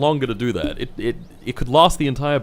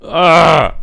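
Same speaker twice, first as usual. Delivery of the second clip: high, loud exasperated yell; drawn-out cry of frustration; frustrated shout; 7 semitones higher, 11 dB louder than usual. Real, not acted. Gamer venting at the failed rocket, frustrated shout.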